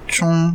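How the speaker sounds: Zhong- held high and level; first tone. Shown as a demonstration. Teacher models Zhong- for learners, high level tone. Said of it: "premier ton".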